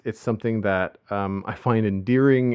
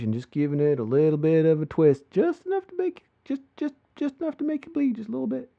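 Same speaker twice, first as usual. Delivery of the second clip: much higher, hushed menacing Southern drawl; Kentucky drawl; 12 semitones higher, faster than usual. Shown as a demonstration. Host imitates a Justified villain's Kentucky drawl for his co-host. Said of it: "Can you do that?".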